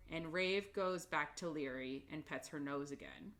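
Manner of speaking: usual speaking voice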